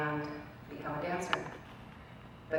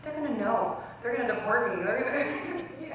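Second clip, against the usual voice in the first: mock fearful; 6 semitones higher than usual. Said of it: "you're afraid".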